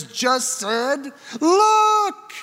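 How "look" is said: high loud call; crying out. Acted. Preacher echoing John's cry to the crowd, high and loud. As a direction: call out like a herald.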